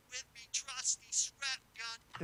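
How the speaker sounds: thin, high and very quiet processed voice; slow, soft, high-pitched character layer; high-pitched light version. Acted. Soloing the light channel for viewers, high pitch. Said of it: "that kinda high pitch".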